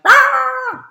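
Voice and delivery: loud, high mock roar; sudden huge chomping "rah"; big bunny bite roar. Shown as a demonstration. Teacher showing what bunnies can't do, loud mock roar. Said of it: "You don't want a bunny going".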